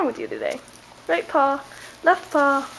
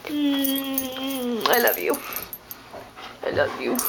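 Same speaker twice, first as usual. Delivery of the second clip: tender affection; 2 semitones lower, 7 dB quieter, slower than usual. Real, not acted. Affection to the dog after kisses.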